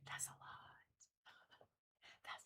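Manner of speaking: whispered aside to herself. Real, not acted. To viewers about forty certifications, whispered. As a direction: breathe it out as an unvoiced aside.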